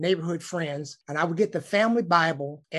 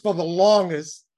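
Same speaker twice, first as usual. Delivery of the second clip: ordinary delivery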